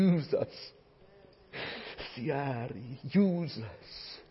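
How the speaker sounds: soft, slow whispered-down prayer; hushed, slow closing prayer; quiet prayer. Real, not acted. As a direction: pray gently, almost breaking. Quiet prayer after emotion.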